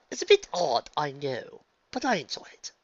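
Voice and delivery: usual speaking voice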